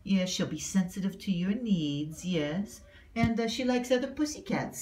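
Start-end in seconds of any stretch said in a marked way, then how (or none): none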